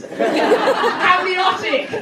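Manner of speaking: high, slow drawn-out cry; triumphant shout. Real, not acted. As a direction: call it out like a quiz win.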